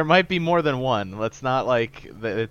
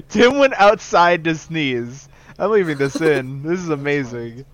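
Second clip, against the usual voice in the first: laughing; 5 semitones higher, 7 dB louder than usual. Real, not acted. Reacting to Tim leaving.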